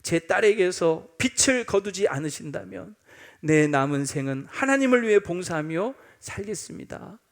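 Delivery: usual speaking voice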